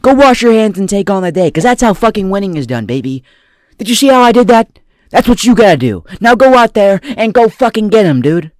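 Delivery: loud, pumped-up coach voice; hammed-up motivational speaker; motivational speech. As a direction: fire up the crowd like a hype coach. That's my motivational segment.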